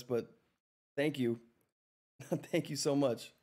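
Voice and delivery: usual speaking voice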